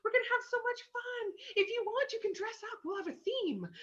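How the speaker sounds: put-on sweet, breathless excitement about girls' night; bubbly girls' night host. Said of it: "The I's are like".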